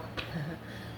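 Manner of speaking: low, breathy little laugh; soft chuckle. Real, not acted. Tarot reader reacting to the Tower card, soft chuckle. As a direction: chuckle quietly under the breath.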